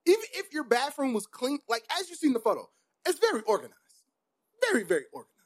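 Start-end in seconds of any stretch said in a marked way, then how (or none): none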